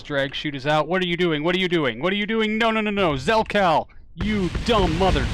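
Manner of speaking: high, loud, building panic; panicked shouting. Real, not acted. Player yelling at Zelkal as the landing fails, panicked shouting. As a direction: yell frantically as it all goes wrong.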